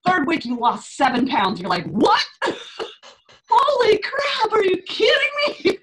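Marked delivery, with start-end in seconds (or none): 1.9-5.8 stunned disbelief. Pretend. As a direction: play a client shocked by the scale, squealing in disbelief. Mock client shocked by weight loss.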